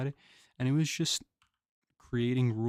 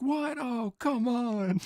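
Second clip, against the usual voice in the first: very high, loud, indignant grandpa, slow on come on; outraged grandpa; 12 semitones higher, 4 dB louder, much slower than usual. Retold or imitated. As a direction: squeal it in mock outrage like an old man. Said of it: "and he was like".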